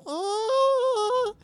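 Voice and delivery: a scrunched-up newborn's squeak; tiny baby sound. Retold or imitated. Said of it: "they just go".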